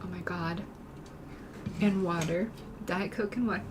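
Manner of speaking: ordinary talk, nothing marked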